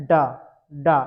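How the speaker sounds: डा said crisply with the tongue curled back, twice; teacher sounding out डा; demo syllable da. Shown as a demonstration. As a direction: model retroflex डा for students. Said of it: "तो आपको डा बोलना है".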